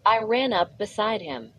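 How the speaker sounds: usual speaking voice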